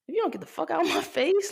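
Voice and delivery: fast, high-pitched mock outburst; indignant retort. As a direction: act out telling someone off, quick and high. Acted retort to the ex.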